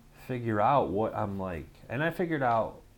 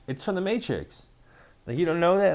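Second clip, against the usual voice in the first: high-pitched, incredulous protest; indignant explanation; 9 semitones higher, 7 dB louder than usual. Retold or imitated. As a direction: insist as if everyone should know. Retold indignant reply to puzzled companions.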